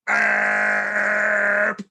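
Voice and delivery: big booming burp sound; loud comic burp. Acted. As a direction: belt out a huge cartoon burp. Mouse burps loudly.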